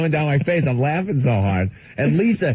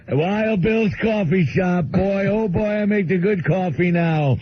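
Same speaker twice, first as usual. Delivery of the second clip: slow, higher-pitched simple-man voice; Bill; 5 semitones higher than usual. Retold or imitated. Bill bragging about his coffee shop, slow high caricature. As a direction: stretch the words in a proud, childlike caricature. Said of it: "they hire him at the school to make coffee".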